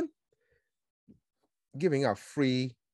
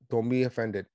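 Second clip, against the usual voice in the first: ordinary talk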